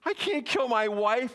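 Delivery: high pleading cry, lightly done; a mock sob over the wife; retold crying. Candidate tells agents, in mock tearful voice. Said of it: "he starts crying".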